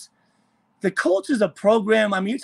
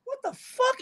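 indignant; 4 dB quieter than usual. Retold or imitated. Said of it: "I'm like".

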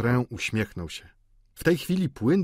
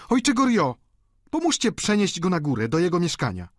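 high, raised call for help; nagląca gospodyni; 9 semitones higher, 4 dB louder than usual. A narrator's reading. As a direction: call him over urgently. Gospodyni woła o pomoc. (wysokie wołanie gospodyni o pomoc)

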